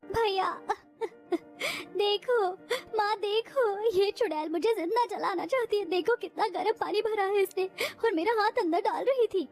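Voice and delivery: high, whiny fake sobbing to the family; a sister's put-on crying to her mother. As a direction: wail to the family as if hurt. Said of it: "जोर जोर से रोने लगती है".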